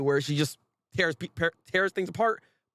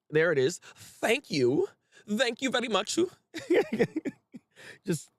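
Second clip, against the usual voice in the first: ordinary talk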